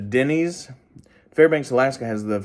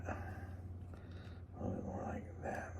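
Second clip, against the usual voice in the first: whisper; 23 dB quieter, slower than usual. Real, not acted. Repeats line, whispered.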